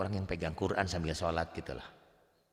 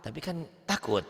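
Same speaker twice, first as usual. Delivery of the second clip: imagined student; 9 semitones higher than usual. Retold or imitated. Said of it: "dipakai sholat kalau belum hafal".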